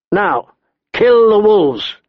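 high, drawn-out order; forceful command. A narrator's reading. Big man ordering the wolves killed, commanding. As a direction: call out the order, stretching the words. Hostage-taker orders the kill.

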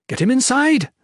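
high loud yell. A narrator's reading. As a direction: yell the order. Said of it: "Maewyn yelled at the knights".

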